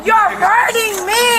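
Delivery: slow, drawn-out shouted protest; pained shout. Real, not acted. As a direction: shout it in pain, dragging the words. Restrained woman protests in pain.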